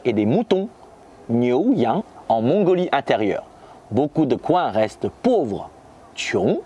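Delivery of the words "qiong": ordinary delivery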